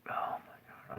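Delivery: whispered to himself, losing composure; a breathy aside under the breath; breathless whisper. Real, not acted. Host losing it on camera, whispered. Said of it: "I'm losing it".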